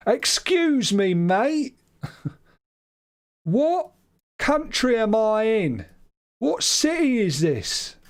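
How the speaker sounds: hammed-up Brit abroad, slow and overly loud; loud slow British tourist. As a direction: talk down to a foreigner, slow and loud. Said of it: "speak loudly and slowly".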